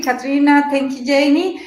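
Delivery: usual speaking voice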